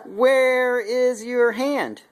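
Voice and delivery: drawn out, very slow question; slow sing-song. Acted. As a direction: stretch every word for the toddler. Quizzing toddler on body parts.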